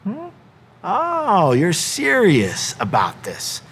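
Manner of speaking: high "oh", then slow realization; intrigued second take. Acted. Jesus realizing the young ruler is serious, intrigued second take. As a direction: do a drawn-out double take, slowing right down. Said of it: "Jesus kinda being like one of these".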